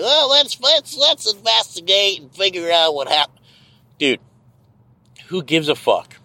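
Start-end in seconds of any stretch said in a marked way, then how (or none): 0.0-3.4 mocking the boss. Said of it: "be like".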